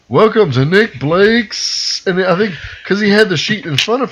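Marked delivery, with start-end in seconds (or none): 0.1-2.0 drawn-out, higher-pitched show welcome; slow, hammy host intro. Retold or imitated. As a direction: stretch the words like a theatre host opening a show. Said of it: "he says".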